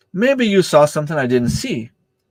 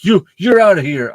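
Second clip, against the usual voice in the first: raised mock shout of the referee; retold ejection; 5 semitones higher, 4 dB louder than usual. Referee ejecting the coach from the game, mock angry shout. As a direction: throw him out, pitched up and louder. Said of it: "He's like".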